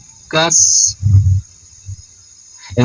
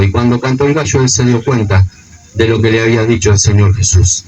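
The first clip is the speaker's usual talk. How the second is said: usual speaking voice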